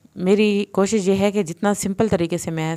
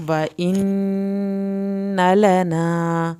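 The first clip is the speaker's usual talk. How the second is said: slow, drawn-out tajweed chant; Quranic recitation; much slower than usual. Shown as a demonstration. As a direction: recite each word slowly, melodically. Recites verse.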